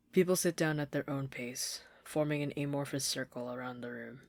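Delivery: plain reading voice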